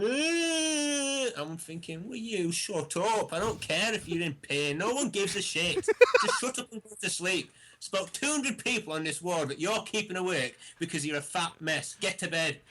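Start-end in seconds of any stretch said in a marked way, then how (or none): 0.0-1.3 long, high-pitched mock moan; imitated patient's wail. Mimicking a noisy patient down the ward, mock wailing. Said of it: "kept getting out of bed going". 2.1-12.6 high-pitched comic irritation; retold exasperated rant. Retelling a rant at the patient keeping the ward awake, exasperated.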